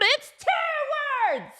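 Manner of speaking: high, laughing mom voice, scolding; hammed-up exasperated mom with a laugh; mock scolding mom. Mom scolding her daughter over spelling, high laughing mock scolding.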